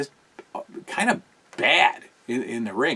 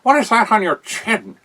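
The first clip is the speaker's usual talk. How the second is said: higher, louder mock Hayes voice; imitating a pompous commentator, higher and louder; Lord Alfred Hayes; 8 semitones higher, 5 dB louder than usual. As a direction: ham up the indignant announcer, pitched up. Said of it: "Lord Alfred Hayes is like".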